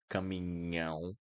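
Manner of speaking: caminhão with nh and a nasal ão ending; Portuguese nasal ão. Shown as a demonstration. Teacher models caminhão, nh plus nasal ão. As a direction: end caminhão through the nose. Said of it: "Truck in Portuguese is caminhão".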